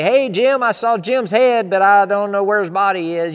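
loud, high mock call across the street; mock greeting. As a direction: play a silly townsman calling out. Mock downtown chat.

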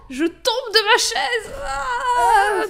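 high, drawn-out mock wail of falling; hammed-up shock. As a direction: wail as if toppling off a chair, obviously faked. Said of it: "je suis re sur ma chaise". (fausse chute, cri traînant et suraigu)

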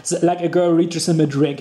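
ordinary talk

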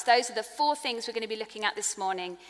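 ordinary talk, nothing marked

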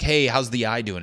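a pushy inner voice checking in brightly; cheery pestering anxiety. Pretend. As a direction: play your anxiety as a nosy, cheerful pest. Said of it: "my anxiety is just following me, being like".

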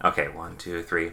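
normal talking voice

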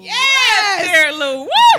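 high, loud whooping cheer; excited cheer. Real, not acted. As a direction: shout it out with glee. Cheering for a celebrity.

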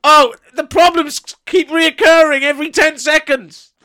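громкая высокая наигранная паника; hammed-up panic. Mock-acting a mishap as Paul.

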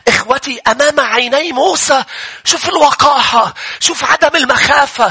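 high and loud scripture preaching; preaching at full force. Acted. Preacher retelling Numbers twenty-five to the church, full force. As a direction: drive the story home to the crowd.

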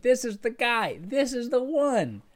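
high, slowing proclamation of the one; John the Baptist. Retold or imitated. John the Baptist pointing to Jesus, high and slow proclamation. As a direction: announce him like John the Baptist. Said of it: "John the Baptist has pointed to him and said".

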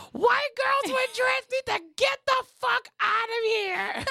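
high laughing shriek of outrage; mock shout. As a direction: yell it high through laughter. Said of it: "and Chelsea was like".

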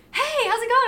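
high, loud greeting called out; mock cheery call. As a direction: imitate a runner hailing strangers loudly. Retelling passing runner's cheery call.

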